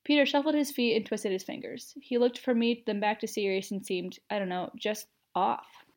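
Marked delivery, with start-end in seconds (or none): none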